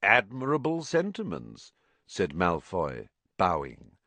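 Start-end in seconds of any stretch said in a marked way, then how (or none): 0.0-1.7 higher, mannered false praise; smooth sarcastic compliment; mocking. A narrator's reading. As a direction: praise it with mild disdain. Malfoy mocks Dumbledore's speech, bowing.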